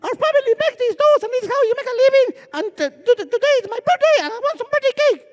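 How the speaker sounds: put-on very high-pitched, quick little kid voice; little brother. Small boy pitching dolls for his birthday cake, high child voice. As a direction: pipe up as an eager small child. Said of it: "and my brother walks up".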